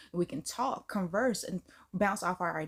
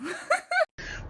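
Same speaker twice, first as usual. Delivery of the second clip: squealing high laugh; 3 dB louder than usual. Real, not acted. After the wild joke, squealing laugh.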